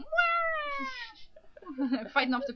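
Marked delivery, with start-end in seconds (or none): 0.0-0.9 high, drawn-out cartoon wail; scrat squeal. Imitating the movie's little scrat fighting piranhas, high squeal. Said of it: "with a little scrat going".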